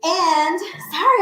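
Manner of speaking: ordinary talk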